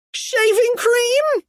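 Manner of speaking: high, drawn-out disbelief; astonished question. A narrator's reading. Kipper hearing Krupp's explanation, high incredulous voice. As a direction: squeak it slowly in astonishment.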